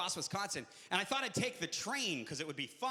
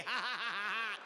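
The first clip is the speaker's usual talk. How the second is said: ordinary talk, nothing marked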